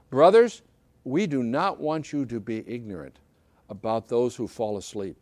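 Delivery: solemn scripture reading. Acted. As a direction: read the holy text with gravity. Reads the scripture passage aloud.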